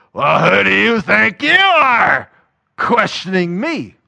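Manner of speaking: loud, slow, indignant scowl; scowling outburst. Retold or imitated. A believer turning on the questioner, loud and scowling. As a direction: bite down on who do you think you are. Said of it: "this scowl raises up on their face".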